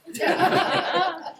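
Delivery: high burst of real laughter; big laugh. Real, not acted. Speaker laughs at own joke.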